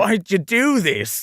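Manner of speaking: high, drawn-out Australian plea; Australian accent. Acted. Voice actor repeating the scripted line in a stretched Australian accent. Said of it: "using the accent again".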